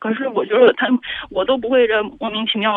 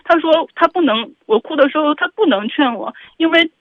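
real tearful upset, voice pitched up; crying; 4 semitones higher, 4 dB louder than usual. Real, not acted. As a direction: plead through tears, higher and louder. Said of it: "那你能不能不哭". (带着哭腔委屈地说)

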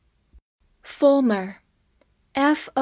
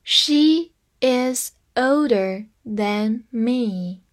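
slow, careful teaching read; teacher modelling slowly; slow demo; much slower than usual. Shown as a demonstration. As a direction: read "She is older than me" very slowly. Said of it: "所以than就放在比较级的后面了".

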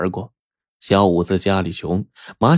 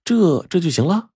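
doubtful; 9 semitones higher than usual. A narrator's reading. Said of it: "满腹狐疑".

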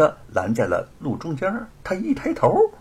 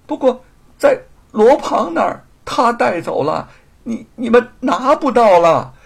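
halting, high-pitched, growing louder; timid, terrified confession; 10 semitones higher, 7 dB louder than usual. A narrator's reading. Thief confessing at sword point, terrified stammer. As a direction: stammer out the truth in fright. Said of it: "吓得心惊胆战，胆怯地点了点头".

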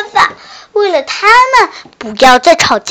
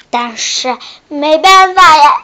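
usual speaking voice

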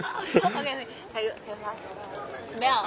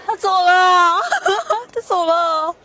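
nervous laugh; 7 semitones higher, 6 dB louder, slower than usual. Real, not acted. Interviewee walked away.

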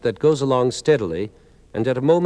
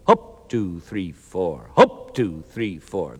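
drill sergeant count; 6 semitones lower, slower than usual. Retold or imitated. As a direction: count off like a sergeant, punch "hop". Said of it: "the sergeant still counts out in four".

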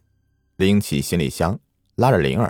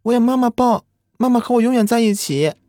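put-on high, eerie little girl voice; a ghost child pleading, much higher; too calm creepy doll; 3 dB louder than usual. A narrator's reading. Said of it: "只是说道".

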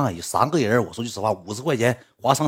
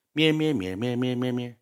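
mock phone babble; 3 semitones higher, 4 dB quieter, slower than usual. Girl chattering on the phone.

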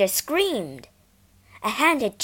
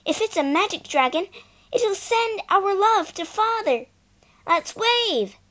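excited; 11 semitones higher, 4 dB louder than usual. Acted. Excited child voice at train.

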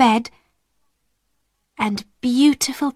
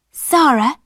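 raised, eager exclamation; excited cry; 4 semitones higher, 3 dB louder than usual. A narrator's reading. Ermengarde remembering her box from home, excited cry. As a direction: cry out the name, lightly acted. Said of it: "she cried".

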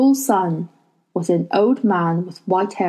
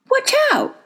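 sudden high warning cry; alarmed warning. A narrator's reading. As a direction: warn them sharply. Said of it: "Lyra said".

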